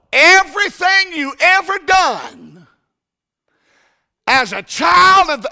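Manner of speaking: high, loud preaching cry; preaching shout. Real, not acted. Preacher shouting the gospel at the congregation. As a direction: lift it to the rafters.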